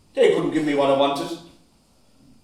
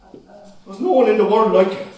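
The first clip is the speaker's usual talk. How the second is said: higher, louder cry of grief; anguished outcry; 5 semitones higher, 5 dB louder than usual. Acted. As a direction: cry it out at him. Son's outburst at father.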